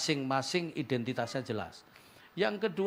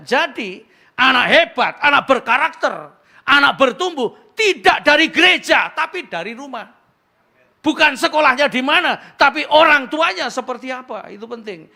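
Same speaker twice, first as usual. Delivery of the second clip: loud, high preaching voice; preaching at full force; 11 semitones higher, 18 dB louder than usual. Acted. Pastor drives the point home to the congregation, loud and high.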